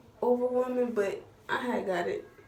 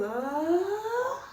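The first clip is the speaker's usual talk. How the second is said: high mock scream of pain; a retold scream, pitched high; 10 semitones higher than usual. Imitating her scream in the dentist chair, high. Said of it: "I'm screaming".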